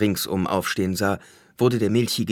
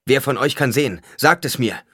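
higher, louder urgent demand; 7 semitones higher, 6 dB louder than usual. A narrator's reading. A security agent questions the blinded men, demanding loudly. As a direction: demand an answer from a group.

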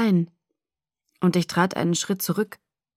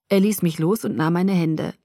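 ordinary delivery, nothing marked

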